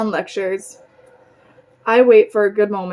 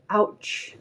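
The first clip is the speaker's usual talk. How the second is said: whisper; 7 dB quieter than usual. Acted. Pinched during church gossip.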